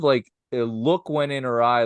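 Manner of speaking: usual speaking voice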